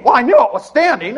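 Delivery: put-on high, loud falsetto old woman snapping back; old lady voice. Second old woman answers her friend in a high falsetto. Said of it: "they bicker back and forth like a couple old ladies".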